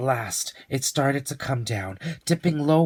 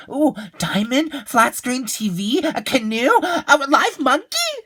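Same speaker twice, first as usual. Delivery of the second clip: much higher, louder eager guessing; excited child; 13 semitones higher, 6 dB louder than usual. Acted. Leo eagerly guesses gifts, excited child voice. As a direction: rattle off gifts with growing delight.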